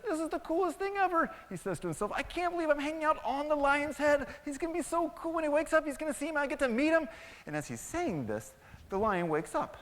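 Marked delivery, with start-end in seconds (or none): 0.0-1.3 high, loud gushing excitement; thrilled mouse. Acted. Excited mouse marvels to himself. 2.1-7.1 very high, loud, giddy mouse voice; starstruck excited mouse. Acted.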